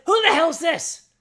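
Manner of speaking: loud, high demand; angry child confronting a stranger on mom's phone; angry yell. Retold or imitated. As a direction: bark at the stranger on the line.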